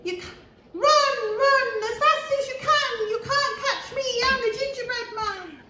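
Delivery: high, loud, teasing taunt; squeaky cheeky runaway; gingerbread man voice. Acted. Cookie taunting the chasers.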